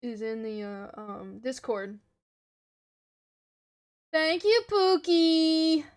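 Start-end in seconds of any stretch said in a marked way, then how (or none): 4.1-5.8 high, loud cutesy thank-you; raised pitch, drawn-out last word; gushing thanks. Real, not acted. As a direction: sing out your thanks to a fan. Streamer thanks a viewer affectionately.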